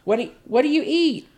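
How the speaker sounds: put-on high, squeaky child's question; mocking the girl's fake surprise; mock little girl. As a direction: ask it like a bratty little girl. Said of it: "she's like".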